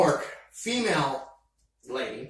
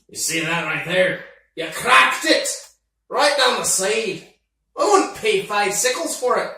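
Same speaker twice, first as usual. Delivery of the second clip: louder, higher indignant scolding; 4 semitones higher, 6 dB louder than usual. Acted. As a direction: tear into the shoddy work. Orc scolds broken blade.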